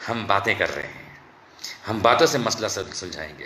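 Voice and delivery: lightly voiced mocking of a timid official; mock government excuse. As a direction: sneer at their meek talk. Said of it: "और अब क्या बोलते हैं?".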